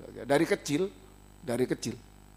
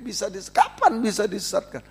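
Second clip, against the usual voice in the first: normal talking voice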